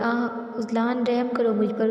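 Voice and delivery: usual speaking voice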